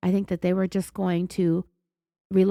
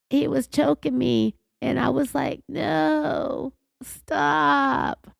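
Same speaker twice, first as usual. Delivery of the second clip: higher-pitched, drawn-out mock forlorn voice with vocal fry; forlorn, dramatic imitation; 6 semitones higher, much slower than usual. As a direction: imitate a suspect playing up the drama in a creaky, forlorn voice. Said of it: "she goes into this forlorn sounding speak that has this really prominent vocal fry".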